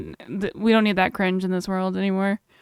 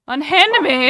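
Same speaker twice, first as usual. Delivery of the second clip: put-on loud theatrical old-fashioned protest; indignant protest; 5 semitones higher, 8 dB louder, slower than usual. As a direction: cry out like a affronted lady.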